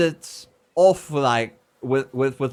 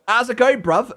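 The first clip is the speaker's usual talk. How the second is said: higher, cheeky Cockney greeting with a laugh; mock Cockney; 6 semitones higher, 3 dB louder than usual. Shown as a demonstration. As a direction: play a cheerful London lad saying hi. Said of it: "learn the Cockney of the accents".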